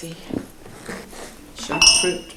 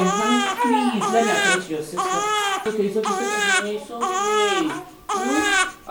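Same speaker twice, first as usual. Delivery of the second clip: put-on soothing baby; 11 semitones higher, 7 dB quieter than usual.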